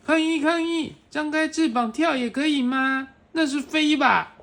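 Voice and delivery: high, indignant protest voice; protesting animals. Acted. Other animals protest in story.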